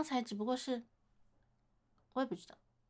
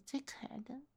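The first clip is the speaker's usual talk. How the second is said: whispered compliment; 9 dB quieter than usual. Acted. Big sister praising shy admirer.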